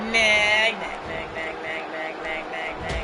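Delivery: mock nagging. Real, not acted. Teasing a fellow player with nag after nag, nagging. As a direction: nag on in a low monotone chant.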